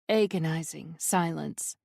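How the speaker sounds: plain reading voice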